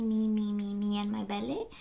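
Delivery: ordinary talk, nothing marked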